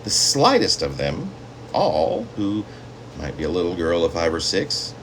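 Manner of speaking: plain reading voice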